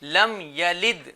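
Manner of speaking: slow, measured tajweed recitation; Quran recitation. Shown as a demonstration. Teacher models the verse for children, slow recitation. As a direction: recite slowly with drawn-out vowels. Recites whole sura for repetition.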